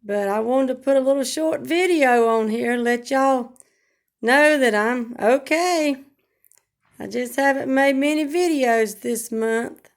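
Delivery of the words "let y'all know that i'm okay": usual speaking voice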